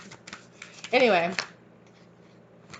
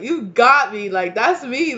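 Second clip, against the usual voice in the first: loud laughing; 5 semitones higher, 8 dB louder, slower than usual. Retold or imitated. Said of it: "they're like".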